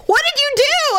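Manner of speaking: high-pitched mock alarm; alarmed husband. Husband calls to wife who fell, alarmed. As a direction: imitate a worried husband calling out, high.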